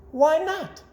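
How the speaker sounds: very high, loud lady's agreement; old lady voice. A narrator's reading. Missus McGillicuddy agreeing to the plan, very high. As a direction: chirp it brightly. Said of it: "said Missus McGillicuddy".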